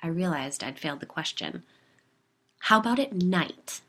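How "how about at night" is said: prodding. A narrator's reading. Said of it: "she prodded".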